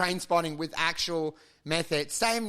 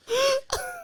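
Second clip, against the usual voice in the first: gasp. Acted. Mock shock.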